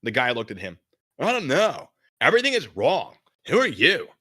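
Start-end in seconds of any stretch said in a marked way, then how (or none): 1.2-4.1 high, loud dazed surfer voice; a shaken California dude asking. Acted. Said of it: "this notebook guy, from California".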